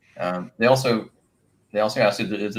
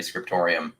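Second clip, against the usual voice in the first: ordinary talk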